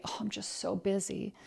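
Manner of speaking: soft, sagging "so busy"; mock self-pity. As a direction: sigh it out like a weary excuse.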